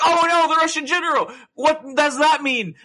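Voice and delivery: high, loud, hammed-up shock; mock alarm. Reacting to the Russian general being shot, mock alarm. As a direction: cry out in exaggerated dismay, drawing out the question.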